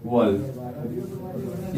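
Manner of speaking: very drawn-out, low and soft; stretched word, trailing upward; slow dictation. Real, not acted. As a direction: say it slowly as you write it. Sounds out word while writing.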